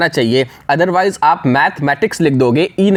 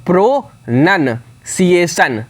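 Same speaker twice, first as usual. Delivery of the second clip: Pronunciation very slow, pro-nun-ciation in three parts; syllable by syllable, pronunciation; split into parts; much slower than usual. Shown as a demonstration. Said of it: "इस तरीके से आप इसे divide करोगे तीन part में".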